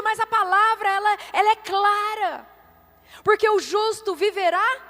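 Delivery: loud, high-pitched sermon crescendo; driving, insistent pulpit cry; fervent preaching. Real, not acted. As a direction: preach the promise at full force. Preaches at full force.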